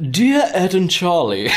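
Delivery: high-pitched mock posh accent; Prince Andrew. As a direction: read it as a hammed-up posh letter-writer. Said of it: "try and do this in a posh accent".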